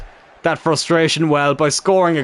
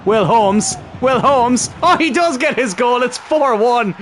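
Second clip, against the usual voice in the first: excitement swelling as he scores; rising excitement; 8 semitones higher, slower than usual. Real, not acted. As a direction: build to the goal call. Late goal being scored.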